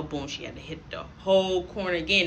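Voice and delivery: ordinary talk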